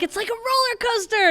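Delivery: put-on high, thrilled kid voice; a child squealing over her own idea; excited kid. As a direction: sell the game to your sister with glee. Kid enthusing about guinea pig game.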